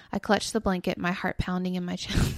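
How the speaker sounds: low, hushed ghost story narration; spooky story reading. Acted. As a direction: read it like a campfire tale. Reads spooky story aloud.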